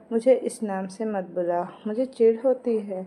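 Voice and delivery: ordinary delivery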